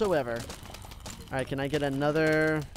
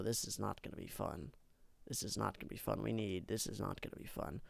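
dread; 8 semitones lower, 17 dB quieter than usual. Real, not acted. Big wave coming, dread.

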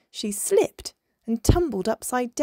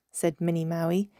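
ordinary delivery

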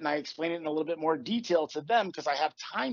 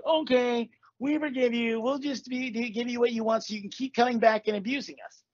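higher, sugary fake forgiveness; mock pushover; 7 semitones higher than usual. Mocking what a jerk expects the community to say, sarcastic. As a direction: play the doormat sarcastically.

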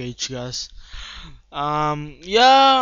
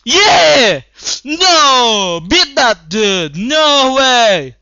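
loud excited cheering; hyped shouting; 5 semitones higher, 10 dB louder, slower than usual. Real, not acted. Gamer urging teammates in final fight, loud cheering. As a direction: cheer your team on at full volume.

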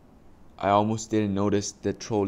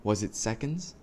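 ordinary delivery, nothing marked

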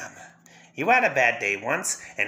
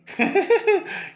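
a short, high cackle; manic laugh; 4 dB louder than usual. Acted. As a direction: burst into a mad giggle. Joker refuses Batman's offer.

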